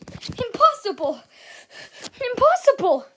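high, loud exclamation; outraged disbelief. Acted. Villain faced with unexpected rivals, loud disbelieving shout.

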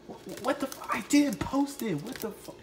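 high, startled cry of denial; shocked denial. Acted. Junior denying the post to Chevy in high alarm. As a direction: blurt it out high and stunned.

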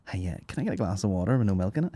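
quick, mocking put-on voice of a fussy friend; mocking fussy customer. Mocking a friend's fussy orders, quick put-on customer voice. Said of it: "I don't know what that voice is".

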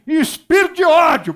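loud, high yell; shouted exorcism. Retold or imitated. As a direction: shout it out as the brother did. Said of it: "o irmão gritou lá no meu ouvido".

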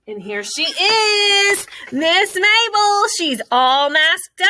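high, slow, delighted reveal; excited introduction. Real, not acted. Teacher excitedly introduces Mabel the toy to the class. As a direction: present her like a big surprise. Introducing stuffed animal to kids.

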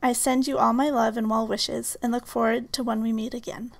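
normal talking voice

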